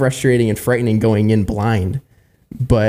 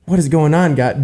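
mildly exasperated plea, pitched up; a touch of higher-pitched exasperation; frustrated; 6 semitones higher than usual. Retold or imitated. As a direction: question God in retold frustration. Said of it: "I would get so frustrated".

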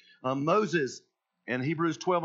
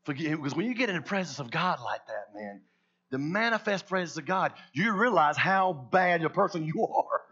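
ordinary delivery

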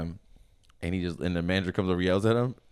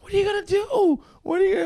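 very high, louder fretful squeal; mock panic; 5 dB louder than usual. Coworkers fret over someone being fired.

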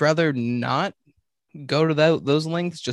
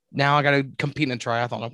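usual speaking voice

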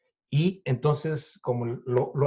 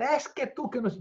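retold yelling; 11 semitones higher, 4 dB quieter than usual. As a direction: imitate an angry rant up high. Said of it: "este me empieza a gritar". (grito imitado, agudo y sin volumen)

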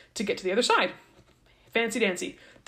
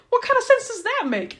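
much higher, squeaky mock-outraged Pharaoh; mock-indignant Pharaoh; 14 semitones higher than usual. Pharaoh scoffing at letting slaves go, much higher and mock-outraged.

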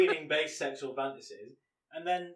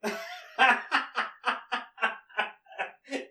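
burst of laughter; 7 semitones higher than usual. Real, not acted. Co-host laughing at the eclair jab. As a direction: crack up at the joke.

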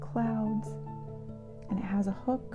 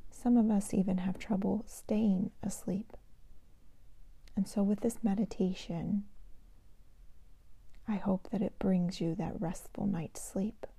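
sleep meditation whisper; 5 dB quieter than usual. Shown as a demonstration. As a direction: whisper them gently toward sleep. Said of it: "I'd like to share a meditation with you today".

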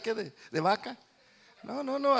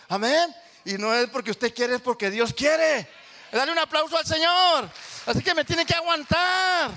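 loud, high, slow preaching, stretching the words; full-force preacher's cry; fiery preaching; 8 semitones higher, 8 dB louder than usual. Acted. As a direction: drive it home from the pulpit.

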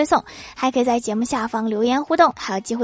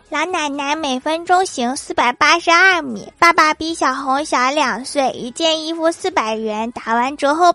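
higher, slow, deliberate reading; slow mock recital; 3 semitones higher than usual. Boy's absurd math answers read out slowly. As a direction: read each nonsense line slowly.

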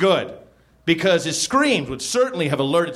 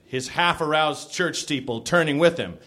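ordinary delivery

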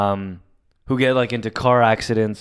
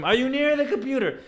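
very high, squeezed mock scream; high-pitched strained fake yell; 13 semitones higher, 5 dB quieter than usual. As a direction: pretend to scream it without the volume. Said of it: "I would scream".

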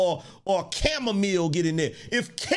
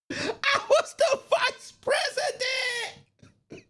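a mock outraged shriek; high, slow laughing wail; much slower than usual. Shown as a demonstration. Acting out the arrested man's outrage in a high slow laughing squeal.

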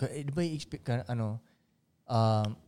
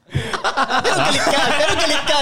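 mock angry; 5 semitones higher than usual. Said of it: "Nagigets mo, galit ka".

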